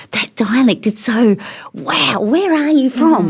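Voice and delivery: high, slow, wide-eyed wonder; mocking Italians gasping at a Southern dialect; mock amazement. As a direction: play a northerner dazzled by the dialect. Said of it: "they'll kind of go".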